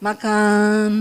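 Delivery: MAKAN dropped low and drawn out; practised gentle fall on "makan". Shown as a demonstration. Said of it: "Suruh belakang turunin".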